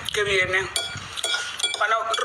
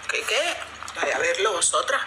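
ordinary delivery

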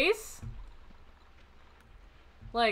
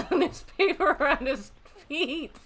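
higher voice squeezed by laughter; laughing; 4 semitones higher than usual. Real, not acted. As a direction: barely get it out for laughing. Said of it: "I'm laughing mostly at".

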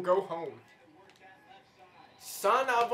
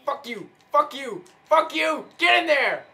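from flat curse to loud shouts; escalating curses, louder each line; building angry yell; 4 semitones higher, 5 dB louder than usual. Real, not acted. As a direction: ramp up the rage each time. Yelling at the game play.

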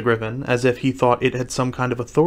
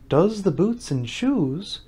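slow, higher puzzled question; wondering; 6 semitones higher, much slower than usual. A narrator's reading. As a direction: echo it in drawn-out wonder. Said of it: "in a wondering tone".

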